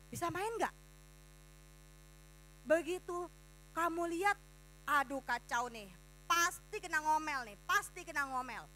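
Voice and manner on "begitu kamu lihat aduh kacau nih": ordinary talk, nothing marked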